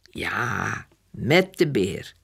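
low, slow, soothing yes; reassuring mother. A narrator's reading. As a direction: confirm it slowly and calmly, pitched low. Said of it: "En dan is Jip gerustgesteld".

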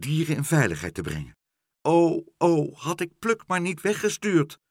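high, agitated lament; worried fretting. A narrator's reading. Meneer Pen regrets sending Pluk away, worried.

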